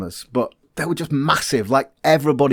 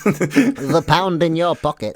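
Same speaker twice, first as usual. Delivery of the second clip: slow, drawn-out impression of Harold Wilson; mock Wilson impression; 5 semitones higher, 4 dB louder, much slower than usual. Host imitating Harold Wilson for co-host, slow and drawn-out. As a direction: ham up the old prime minister's catchphrase.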